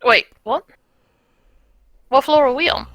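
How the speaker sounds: ordinary talk, nothing marked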